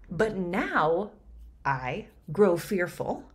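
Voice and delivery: measured verse reading; Shakespeare reading. Shown as a demonstration. Reads the verse.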